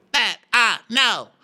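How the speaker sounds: high, insistent mock declaration; Sutton. Sutton defending herself to Diana, high insistence. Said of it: "you be Sutton".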